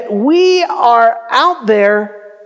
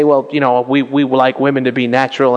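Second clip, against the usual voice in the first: lightly voiced prim churchgoer, lower and quick; a hint of a prim, disapproving church lady; mock legalistic folks; 9 semitones lower, faster than usual. Pastor mocking legalistic folks who reject makeup, lightly prim. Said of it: "some legalistic folks that, uh, that say".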